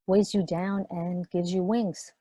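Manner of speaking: normal talking voice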